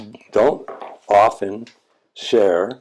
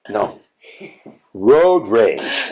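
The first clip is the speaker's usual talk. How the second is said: road rage pressed out louder; insist, a little louder, on road rage; stressing road rage with more force; firm insistence; 4 dB louder, much slower than usual. Retold or imitated. Student insists again, firmer.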